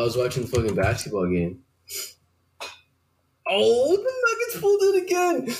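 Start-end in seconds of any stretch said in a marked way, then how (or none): 3.5-5.5 sudden high excited exclaim; excited high call. Real, not acted. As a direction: blurt it out, way up high. Spoiling the game result.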